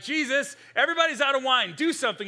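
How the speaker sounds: loud, high nagging mother. Retold or imitated. Mother pressing her son to act, loud mock nagging. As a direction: nag him like a pushy mom.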